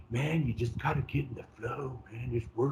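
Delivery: mellow hippie guru. Retold or imitated. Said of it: "it almost sounds like a hippie".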